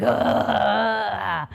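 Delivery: long, imitated labour growl; bear-like growl. Reliving labour for listeners, bear-like growl. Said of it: "growling like a bear".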